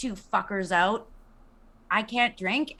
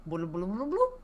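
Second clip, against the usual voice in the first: bubbly bloop rewind noise; tape rewinding; 3 semitones lower, 4 dB quieter than usual. Pretend. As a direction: make a mock tape rewind sound. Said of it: "that's your rewind noise".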